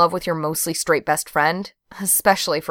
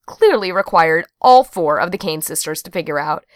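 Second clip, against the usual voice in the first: usual speaking voice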